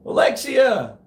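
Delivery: high, loud call. Real, not acted. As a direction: call the name sharply across a distance. Calling out to Alexa.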